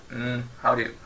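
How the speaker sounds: normal talking voice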